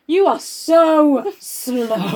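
mock someone sluggish; very slow mock drawl. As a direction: drag each word out slowly. Mocking Jem's slow speech.

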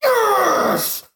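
long, very high victory yell; real triumphant cry. Real, not acted. Player winning the game, jubilant. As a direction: let out a jubilant squeal. Wins the last quiz round.

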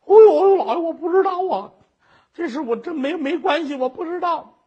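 high, loud cry of panic that stays high; 惊恐哀求; frightened plea. Acted. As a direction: beg the judge, shaking with fear. Terrified woman pleads innocence.

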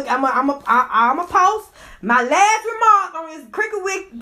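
ordinary talk

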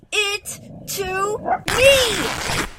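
slow, strained, rising command; exasperated demand. Acted. New owner wrestles blanket from her dog, exasperated. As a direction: drag out each word, straining in a tug-of-war.

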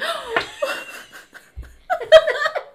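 very high, loud squealing laugh; long, real, helpless cackle; burst of laughter. Real, not acted. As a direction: collapse into high giggles. Cracks up retelling.

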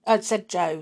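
ordinary delivery

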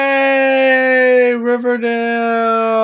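high, loud, drawn-out cheer; mock cheer. Co-host cheering for the show in a high, loud, drawn-out voice. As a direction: cheer like an overexcited fan.

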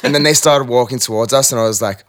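ordinary delivery

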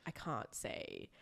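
slow, whispered mock distress; mock whimper. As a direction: breathe it out slowly like a half-blind wretch. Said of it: "I woke up the other day and I was like".

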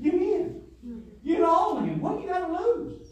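high, loud pleading; fervent high call to come in; loud preaching call. Acted. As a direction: shout the invitation. Preacher voicing Jesus, loud.

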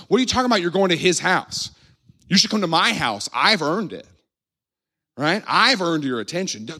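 high, mock-jealous objection; indignant complaint. Jealous neighbour objects to Jesus's choice, acted indignation. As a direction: argue your case, acting affronted.

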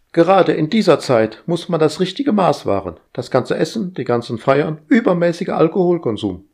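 high, bossy, busy voice; fussy household robot. Acted. Household AI fussing at Roger, high and bossy. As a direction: nag like an overeager house AI.

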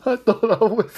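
high, breaking off into laughter; trying to talk through the giggles; laughing. Real, not acted. Host laughing at himself, voice cracking up mid-sentence. Laughing after mixing up rappers.

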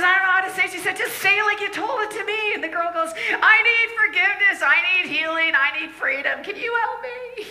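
high, loud, pleading cry for help; desperate girl. Retold or imitated. Crying girl in the brothel begging, high and loud. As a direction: beg for help, voice high and pushed.

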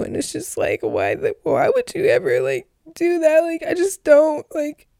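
high, cracking, tearful voice; words breaking up with crying. Real, not acted. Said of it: "you can hear my crying".

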